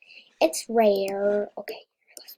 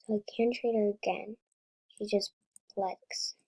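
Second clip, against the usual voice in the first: ordinary delivery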